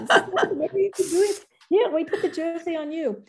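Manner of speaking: high, fast, hammed-up French accent; bad French accent. Officials insisting on the jersey, in a bad French accent, fast. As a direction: rattle it off like a flustered French official. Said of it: "bad French accent".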